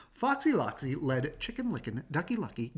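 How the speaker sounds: usual speaking voice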